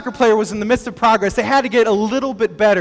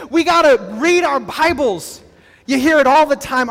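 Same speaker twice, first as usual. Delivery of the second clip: higher, driven preacher exhortation; forceful preaching; 6 semitones higher, 4 dB louder than usual. Real, not acted. Pastor urging congregation to seek encouragement, forceful preaching. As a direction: preach it with urgent, raised energy.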